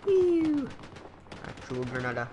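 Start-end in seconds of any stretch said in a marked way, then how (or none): 0.0-0.7 squeaky whew. Pretend. Relief after nearly blowing up.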